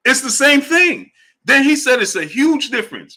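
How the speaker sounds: exasperated at chat's logic; incredulous. Real, not acted. Exasperated peak of argument.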